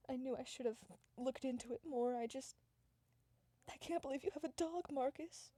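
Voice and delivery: put-on high, distressed girlfriend voice; hammed-up upset, pitched well up; acted dismay. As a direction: break the bad news in high-pitched disbelief. Acted breakup, Christina upset about dog.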